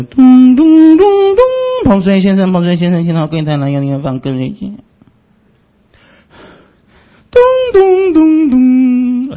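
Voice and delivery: 高声唱出"咚咚咚咚"的广播铃声，再广播寻人; 学百货公司广播找人; mock store announcement. (模仿百货公司广播)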